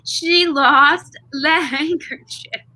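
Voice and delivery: high, drawn-out mock wail with laughter; mock outrage. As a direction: stretch the complaint, laughing through it. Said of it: "And he's like".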